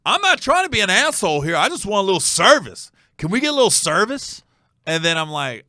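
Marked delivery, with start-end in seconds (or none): 0.1-4.4 put-on drunk complaint. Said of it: "He goes".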